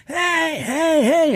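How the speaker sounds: high mock yell of the angry neighbor; retold scream. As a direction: yell hey like a man chasing you off. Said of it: "he starts screaming".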